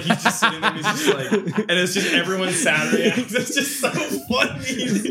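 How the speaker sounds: high, laughing retelling. Real, not acted. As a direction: squeal it through giggles. Laughing while retelling meme.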